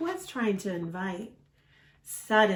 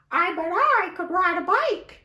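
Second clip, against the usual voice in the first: high, squeaky cartoon duck; 11 semitones higher, 5 dB louder than usual. Reading to Ruben, a high excited duck voice. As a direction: chirp it like an eager little duck. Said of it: "we have to use the voices".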